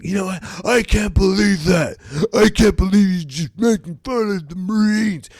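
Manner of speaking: high mock-outraged whine; angry Marine. Mocking the pissed-off Marine in a high whiny voice. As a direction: squeak it like an offended Marine. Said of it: "He's like".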